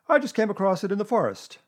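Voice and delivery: loud, high-pitched Owl; chatty Owl. A narrator's reading. Owl telling Pooh of his find, chatty. Owl explains where rope came from.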